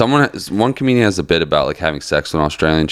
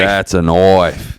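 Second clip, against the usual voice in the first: very slow, broad Aussie vowels; mock Australian accent; much slower than usual. Echoing the co-host's knife line, mock Australian accent. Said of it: "What other Australian stereotypes do we know?".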